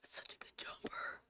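breathy awed whisper. Real, not acted. Streamer to chat admiring the sweater, whispering. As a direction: whisper it in longing.

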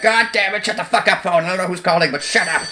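exasperated yell at an interrupting phone; annoyed outburst. Retold or imitated. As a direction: act out cursing at a ringing phone. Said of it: "I'm like".

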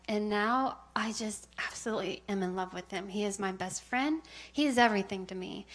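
ordinary delivery

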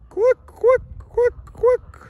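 very high, very loud honking quacks; shrill loud rapid mock quacking; goose quacking imitation. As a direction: honk like a whole flock of geese to call them over. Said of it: "that was me".

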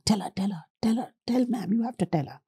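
hushed, urgent whisper, nudging a friend. Retold or imitated. Friend whispering to the girl to confess to ma'am, hushed. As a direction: whisper it so the teacher barely hears.

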